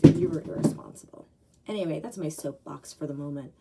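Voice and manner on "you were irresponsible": louder, hammering the blame; a rant peaking on the final word; emphatic accusation. Real, not acted. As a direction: land the accusation hard. Soapbox rant climax.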